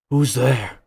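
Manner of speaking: loud, gruff prisoner calling out. Acted. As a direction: bark it out from a dark cell. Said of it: "you hear a gruff voice ask".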